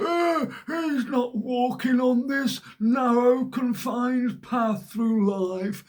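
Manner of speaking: hammed-up tutting herd member; mock rule-follower. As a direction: drag it out like a disapproving prude. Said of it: "they've got something to say about that".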